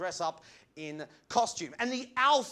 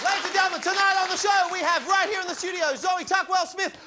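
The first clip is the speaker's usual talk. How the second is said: announcer shout; 10 semitones higher, 9 dB louder than usual. Acted. Hammy TV host intro shout.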